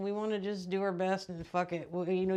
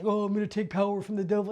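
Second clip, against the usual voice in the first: mocking glamorizer; 4 dB louder, faster than usual. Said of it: "start glamorizing".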